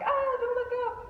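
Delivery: high panicky yelp; mock panic. Rider telling herself not to look up, mock panic. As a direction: yelp it in high-pitched alarm. Retold panic looking up on ride.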